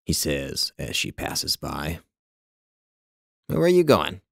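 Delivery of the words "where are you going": high, loud inquisitive call; nosy question, pitched well up; curious. A narrator's reading. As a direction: ask with piqued curiosity. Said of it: "With a tone of curiosity".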